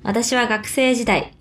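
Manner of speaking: ordinary talk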